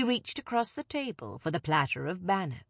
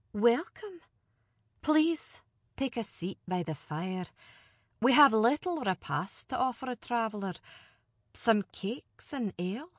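higher, measured noblewoman; grave lady; 5 semitones higher than usual. Acted. Alina welcoming the envoy, gravely and courteous. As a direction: welcome him with solemn courtesy. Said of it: "Alina said gravely".

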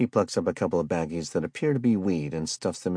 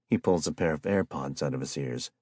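ordinary delivery

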